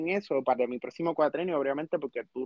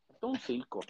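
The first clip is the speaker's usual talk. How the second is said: muttered quiet; 5 semitones lower, 10 dB quieter than usual. Real, not acted. Repeats it, muttered aside.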